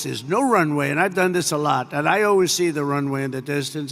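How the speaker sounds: normal talking voice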